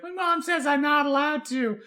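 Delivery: put-on high childish little boy voice; squeaky little Peter, proud and obedient; kid voice. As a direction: pipe it up like a small kid. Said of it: "He goes".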